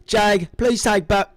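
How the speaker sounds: put-on loud, slow Australian accent; Bowie Jane Australian accent. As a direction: do a hammed-up Australian impression. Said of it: "One more Bowie Jane accent from you".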